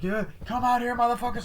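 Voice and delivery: high, strained taunting call; mock yelling. Retelling a challenge to the hidden killer, mock yell.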